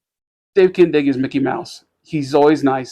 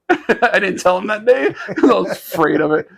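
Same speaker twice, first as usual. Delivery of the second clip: laughing through a confession, higher pitch; 6 semitones higher than usual. Real, not acted. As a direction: tell it through real giggles. Laughing while confessing own mishap.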